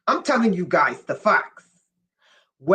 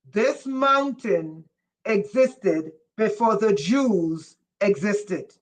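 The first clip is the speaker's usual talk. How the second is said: slow emphatic repeat. Shown as a demonstration. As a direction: repeat it slowly for the ones who missed it. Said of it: "I said".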